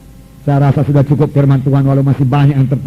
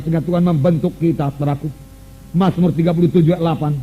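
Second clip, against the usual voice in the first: ordinary talk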